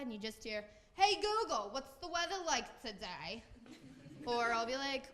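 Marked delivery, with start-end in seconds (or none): 0.9-3.4 high Jersey-mom call to a smart speaker; New Jersey mom impression. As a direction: imitate a Jersey mother calling out, pitched up. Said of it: "she has the very strong accent".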